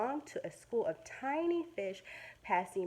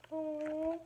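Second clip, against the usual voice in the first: sympathetic aw; 6 semitones higher than usual. Acted. Pity at capture.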